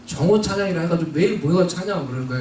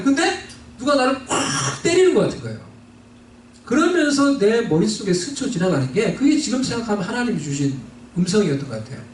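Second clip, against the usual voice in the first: usual speaking voice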